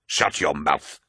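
contained flash of temper; angry snap. A narrator's reading. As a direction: cut him off angrily. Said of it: "snapped Torgadden".

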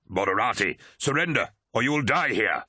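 forceful command, pitch lifting; a contained shout, ordering surrender; narrated shout. A narrator's reading. Commander ordering the moderati to surrender, shouted. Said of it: "shouted Turnit".

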